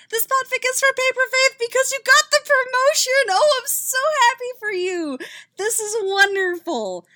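high, loud, gushing congratulations; excited. Real, not acted. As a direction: squeal with joy for her friend.

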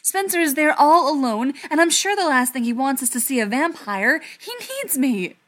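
loud, high, urgent pleading. A narrator's reading. Ryan arguing that Spencer needs him, loud and plaintive. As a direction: plead with the volume up. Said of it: "the way the volume kept rising".

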